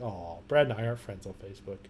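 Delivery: low, soft, mildly forlorn aside; mock disappointment. Man recalling why he never sends friend request, mock disappointment. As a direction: sigh it out quietly, as if let down.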